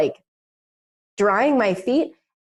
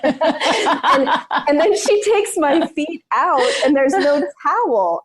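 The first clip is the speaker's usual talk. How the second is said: laughing; 8 semitones higher than usual. Real, not acted. Laughing retelling, high pitched.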